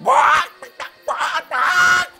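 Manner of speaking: loud high squawks; loud high hen squawks; chickens squawking. Acted. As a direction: squawk like startled hens. Chickens cluck.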